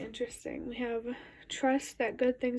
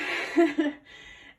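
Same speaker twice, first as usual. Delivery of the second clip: laugh; 5 semitones higher, 9 dB louder than usual. Real, not acted. Laughs at own rushing.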